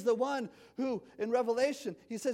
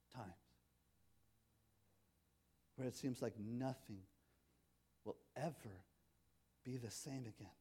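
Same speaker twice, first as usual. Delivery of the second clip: quiet tender sermon ending; soft reflective close; 4 semitones lower, 10 dB quieter than usual. Acted. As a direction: gently comfort a hurting congregation. Closing reflective appeal.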